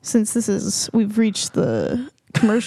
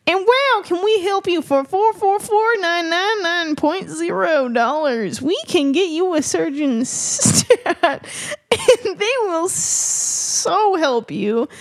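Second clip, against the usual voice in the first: slow, higher hammed-up ad read; mock commercial; 7 semitones higher, slower than usual. [name] does a fake ad for the podcast break, as a hammed-up infomercial. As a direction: sell it like a cheesy infomercial. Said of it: "We're sponsored".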